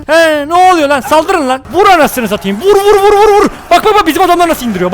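high, loud cries of vur and bak; excited battle shouting. Real, not acted. Urging his soldiers to strike, shouting excitedly. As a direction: cheer the troops on at full voice.

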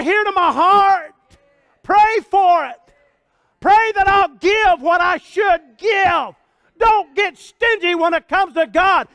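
loud, high, fervent preacher cry; full-force preaching. Acted. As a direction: preach it hard to the congregation.

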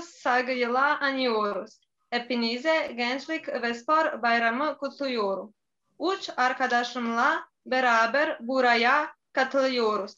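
Bosnian accent in Turkish; careful learner's Turkish with a Bosnian accent; foreigner broken turkish. Real, not acted. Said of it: "Üç yıldır Türkçe öğreniyorum".

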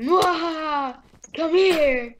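hammed-up evil cackle, then a yell; mad scientist laugh. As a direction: gloat like a cartoon villain. Villain chasing friend.

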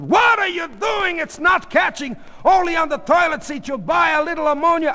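high, loud protest; frantic yelling. Acted. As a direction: yell back to stop her. Husband yells back in quarrel.

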